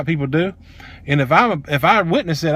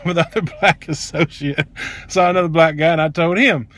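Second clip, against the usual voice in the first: ordinary delivery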